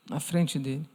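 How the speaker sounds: ordinary talk, nothing marked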